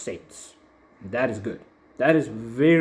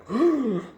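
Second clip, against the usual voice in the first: a quick, high mock gasp of shock; imitated high gasp; 12 semitones higher than usual. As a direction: gasp like a curious fan. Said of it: "some people are like".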